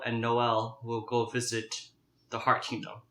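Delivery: ordinary delivery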